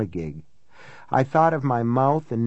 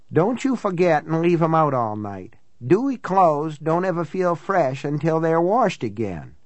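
higher-pitched country housekeeper voice; folksy housekeeper, pitched up; local housekeeper; 4 semitones higher than usual. Acted. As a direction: instruct him like a seasoned local housekeeper. Housekeeper character giving instructions.

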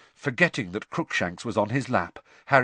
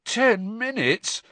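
croaking; 10 semitones higher, slower than usual. Acted. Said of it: "Harry croaked".

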